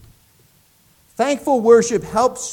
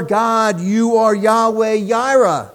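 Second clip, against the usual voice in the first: loud, ringing proclamation of God's name; building praise; 3 semitones higher, 5 dB louder, slower than usual. Acted. Preacher proclaiming God's names to congregation, loud and ringing. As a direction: proclaim it with full force.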